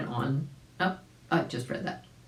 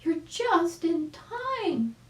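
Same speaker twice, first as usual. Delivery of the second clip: much higher, delighted chick voice; exclaiming chick; 14 semitones higher, much slower than usual. Acted. Arabella greeting Uncle Wiggily, high delighted chick voice. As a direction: squeal with joy at seeing him.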